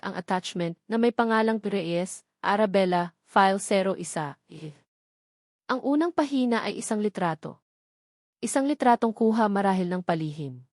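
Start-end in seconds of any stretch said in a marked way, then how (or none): none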